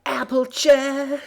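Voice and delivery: high, loud, drawn-out cry for help; desperate scream. A narrator's reading. Apple Bloom screaming for Applejack, high and loud. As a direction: scream for your sister. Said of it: "silencing her scream".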